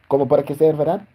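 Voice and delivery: Spanish with a laugh, slightly high; mock stupid Spanish. Teasing the teacher, laughing mock Spanish. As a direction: toss off Spanish through a laugh. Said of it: "really stupid Spanish".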